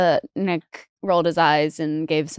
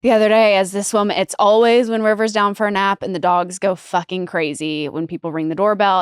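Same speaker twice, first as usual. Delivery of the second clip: usual speaking voice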